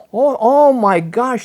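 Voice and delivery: slow, drawn-out dismayed cry; a startled exclamation, stretched out; retold shocked cry. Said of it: "started screaming to him".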